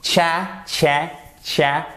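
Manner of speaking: cha as "tchè", repeated; English first syllable. Shown as a demonstration. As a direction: drill cha with hard "tch" and A near è. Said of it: "c'est cha".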